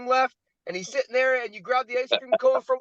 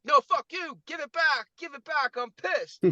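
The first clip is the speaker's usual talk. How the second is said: mock outraged demand; 6 semitones higher, 4 dB quieter than usual. Acted angry demand at the universe.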